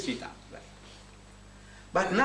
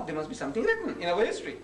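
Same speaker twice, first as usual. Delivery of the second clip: usual speaking voice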